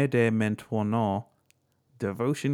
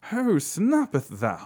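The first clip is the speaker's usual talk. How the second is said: high, slow, hammed-up old English accent; mock olde English; 10 semitones higher, 4 dB louder, slower than usual. Podcaster jokes how the king said it, mock olde English accent. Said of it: "I don't think it was from, you know, Louis the thirteenth".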